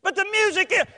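high, loud fretting; mock panic. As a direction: burst out high and alarmed, ready to be cut off. Mock fretting, then hushed.

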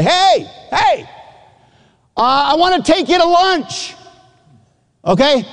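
high, far-carrying shout across campus; yelling from far off. Retold or imitated. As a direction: call out to someone a couple hundred yards away. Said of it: "I'm yelling at you".

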